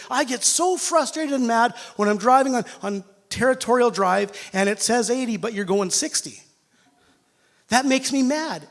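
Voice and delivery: usual speaking voice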